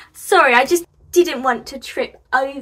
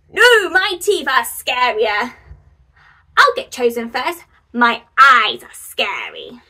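boasting pumpkin; 8 dB louder than usual. Acted. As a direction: show off like a proud spooky pumpkin. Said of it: "This one says".

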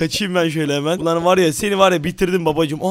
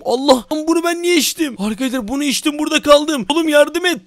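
mock panic; 8 semitones higher than usual. Stuck on ceiling, panics.

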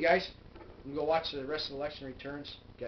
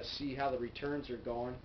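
ordinary delivery

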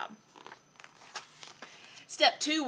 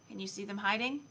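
plain reading voice

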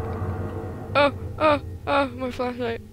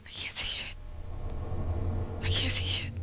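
whispering; 10 dB quieter than usual. Real, not acted. Whispering in the dark.

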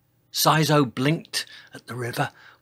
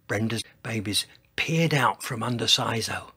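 usual speaking voice

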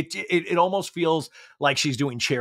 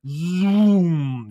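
ordinary talk

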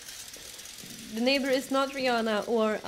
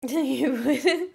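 laughing; 7 semitones higher, 7 dB louder, much slower than usual. Real, not acted. Laughing at chat's answer.

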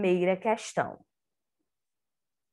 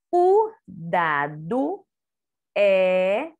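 slow reading aloud; 3 dB louder, much slower than usual. Shown as a demonstration. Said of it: "Vamos ver".

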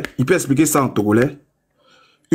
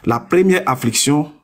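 ordinary delivery

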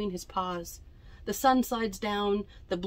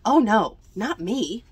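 scared refusal; 7 dB louder than usual. Acted.

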